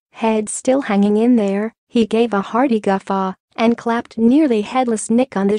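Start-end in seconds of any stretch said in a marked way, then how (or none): none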